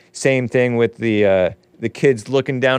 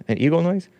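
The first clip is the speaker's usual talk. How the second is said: ordinary delivery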